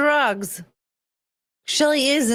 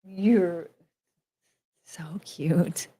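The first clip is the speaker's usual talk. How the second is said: whispered coo; 4 semitones lower, 6 dB quieter, much slower than usual. Real, not acted. Cooing to the pet.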